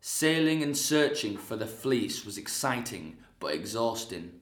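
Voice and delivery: measured storyteller's read; dramatic narration. Acted. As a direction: set the legend up with weight. Dramatic narration before monologue.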